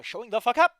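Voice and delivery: sudden loud burst of irritation; pointed outburst. Real, not acted. Streamer thanking listeners, jabbing at no-show, pointed outburst. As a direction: build to a loud jab on the last words. Jab at the absent friend.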